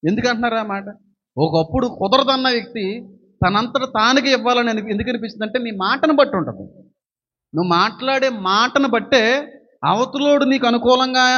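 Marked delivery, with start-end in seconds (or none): none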